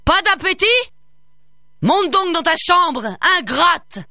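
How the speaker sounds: raging father ogre; ogre yelling. A narrator's reading. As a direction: banish the girl to her room. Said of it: "hurla-t-il à sa fille".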